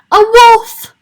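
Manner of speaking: high, loud startled shriek; frightened cry. Acted. Pigs shrieking at the wolf at the door, terrified. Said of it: "cried the three little pigs".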